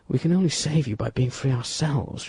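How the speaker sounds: usual speaking voice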